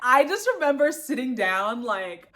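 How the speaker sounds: usual speaking voice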